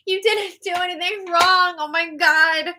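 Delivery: high, pleading indignation; exasperated protest. Real, not acted. Host defending Taylor, exasperated protest.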